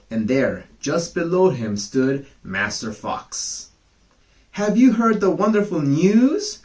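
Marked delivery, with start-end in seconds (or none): 4.6-6.6 high, loud, eager question; joyful and excited. Acted. Fox luring the cock down, joyful and excited. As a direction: burst out with the big news. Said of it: "in a very joyful and excited manner".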